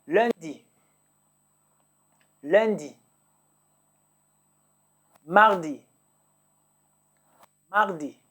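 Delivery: each French day said twice, clearly, with a falling tune; repeat-after-me drill. Shown as a demonstration. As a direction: model each day twice for the class to echo. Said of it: "So repeat after me".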